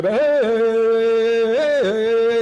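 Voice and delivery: loud, high rhythmic shouts; rowdy chanted hey; imitated call. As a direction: bark it out like the men in the lodge. Men calling out while beating themselves.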